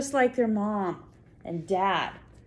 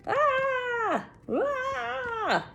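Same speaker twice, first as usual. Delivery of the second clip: playful high mock shriek; mock scream. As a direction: pretend to be scared of the spider for the child. Mock fright at spider picture.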